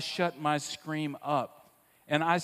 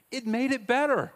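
emphatic lift; 9 semitones higher, 6 dB louder than usual. Real, not acted. Emphatic childhood memory.

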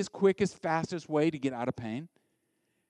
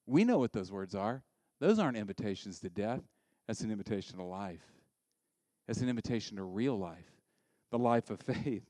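soft, low, measured counter-voice; gentle low reply, set against an outburst; the Spirit answering; 6 semitones lower, 6 dB quieter than usual. Acted. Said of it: "The Spirit over here".

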